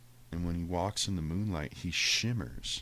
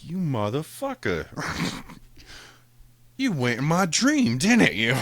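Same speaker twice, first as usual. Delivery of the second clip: higher and louder, mock outrage; laughing accusation; 9 semitones higher, 10 dB louder than usual. Teasing the cat who invaded his dream, loud mock outrage. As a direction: accuse him, half laughing, voice rising.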